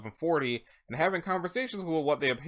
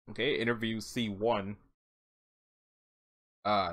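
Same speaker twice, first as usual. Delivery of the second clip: ordinary delivery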